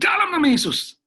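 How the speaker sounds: loud, high-pitched invocation; re-enacted cry of spiritual authority; commanding shout. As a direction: shout it like an order to back off. Retold command to armed robbers.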